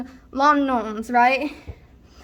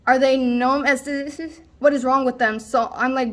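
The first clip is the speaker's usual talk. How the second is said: usual speaking voice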